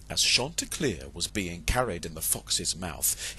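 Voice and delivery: ordinary delivery, nothing marked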